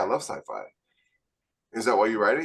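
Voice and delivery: ordinary delivery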